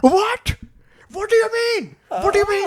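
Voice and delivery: squeaky indignant rich man; shrill offended VIP; high outraged customer. Retold or imitated.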